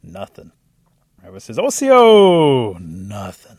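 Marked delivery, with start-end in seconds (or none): 1.6-2.8 very loud, high, drawn-out call; hollered greeting. A narrator's reading. Rabbit hailing the silent creature, hollering at full force. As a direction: belt out the hail, stretching the last sound.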